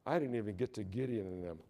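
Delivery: ordinary talk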